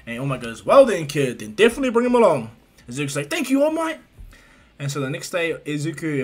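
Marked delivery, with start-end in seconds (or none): 0.7-2.5 louder, higher hearty go-ahead; big-hearted agreement; hearty approval. Retold or imitated. All Might cheerfully grants the request. 3.3-4.0 high, louder grateful cry; acted boyish gratitude; excited thanks. Boy thanks his hero.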